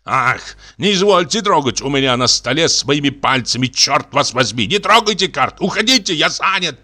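high-pitched, fussy annoyance; irritated dismissal. Acted. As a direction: shoo him away from the cards, rising in pitch. General snaps over his cards.

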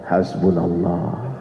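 very low, slow and soft; slow, hushed words of trust in God; Ibrahim's calm answer. Acted. Nabi Ibrahim answers Jibril's offer of help, low and calm. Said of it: "Apa jawaban Nabi Ibrahim?".

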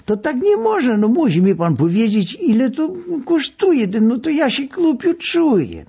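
patient pestering doctor about payment; insistent pleading. A narrator's reading. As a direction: beg the doctor to name his fee. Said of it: "natarczywiej prosiłem".

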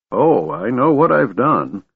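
put-on high, slow old man's voice; mumbling old man. A narrator's reading. Old man answers Tanis's scolding, mumbling. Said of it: "Fizban mumbled".

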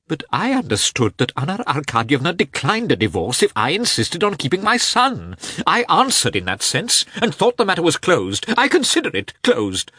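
high, loud, agitated shriek; shrieking Karenin. A narrator's reading. Karenin insists the matter is closed, shrieking. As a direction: build to a high-pitched shriek. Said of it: "shrieked Karenin".

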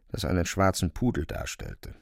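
normal talking voice

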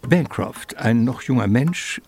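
usual speaking voice

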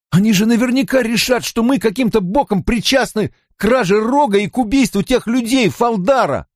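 very high, agitated voice; panicked ranting. A narrator's reading. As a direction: rant in alarm at your doomed friends. Mat panics that all will die. (паническая тирада высоким голосом)

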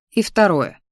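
plain reading voice

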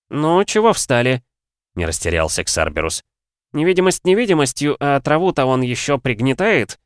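put-on a spry, proud old alchemist. A narrator's reading. Voiced old alchemist Xarberus.

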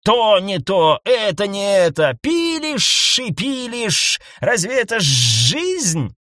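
loud, slow exasperated complaint; exasperated rant. A narrator's reading. Husband vents at wife. (раздражённая тирада)

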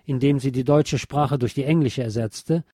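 ordinary talk, nothing marked

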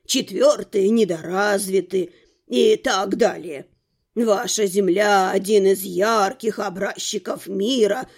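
put-on low, slow old man's voice; self-important old man. A narrator's reading. Old man explains the worlds to a newcomer, self-important. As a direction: lecture slowly in a low, old man's register. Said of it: "важно проскрипел старик".

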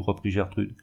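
plain reading voice

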